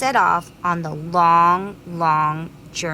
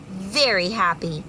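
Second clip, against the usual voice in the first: usual speaking voice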